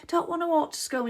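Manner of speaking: put-on high whiny kid voice; whining child. Mum rushing the kids, child whines back high. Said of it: "I'm having a role play with myself".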